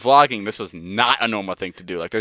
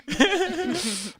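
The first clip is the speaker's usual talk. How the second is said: long high-pitched laugh trailing off; high laughter; 5 dB quieter than usual. Real, not acted. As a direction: crack up at the punchline. Laughing at his own story.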